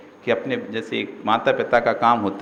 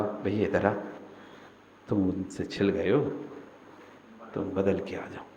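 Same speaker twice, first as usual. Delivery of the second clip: soft, low and gentle; loving tone; 6 semitones lower, 10 dB quieter than usual. Shown as a demonstration. Guru shows disciples the kind way to call, soft. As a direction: call him over kindly, like a brother. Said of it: "चाहे उसको प्यार से बुलाओ".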